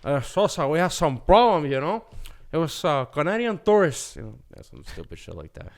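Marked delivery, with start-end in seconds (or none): 0.0-4.2 hammed-up movie gangster reporting trouble; Scarface.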